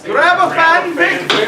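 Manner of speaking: high, slow playful repeat; mock echo. Real, not acted. Co-panelist echoing the host's call, high and teasing. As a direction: throw the line back with a grin.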